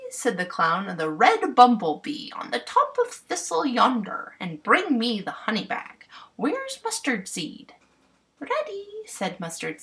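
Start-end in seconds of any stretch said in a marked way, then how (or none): 1.0-7.8 louder, higher bumpkin giving orders; bossy clown voice. Acted. 8.4-8.9 very high, squeaky fairy; tiny fairy voice. Acted. Fairy answers.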